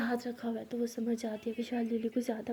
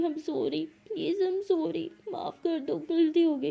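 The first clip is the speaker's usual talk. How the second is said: crying apology; 6 semitones higher, 5 dB louder, much slower than usual. A narrator's reading. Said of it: "तो लक्ष्मी रोने लगती है".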